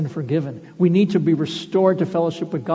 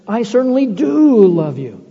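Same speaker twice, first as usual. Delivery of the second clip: mock bold claim; 5 semitones higher, 8 dB louder than usual. Mock answer Peter did not give.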